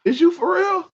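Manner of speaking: high, incredulous comeback; sky-high mock outrage at a backhanded compliment; incredulous retort. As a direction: fire it back in disbelief. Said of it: "I'd be like".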